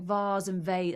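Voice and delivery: "vase" as vahz, then as vayz; two pronunciations. Shown as a demonstration. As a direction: say "vase" British, then American. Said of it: "I don't like it when Americans say vase".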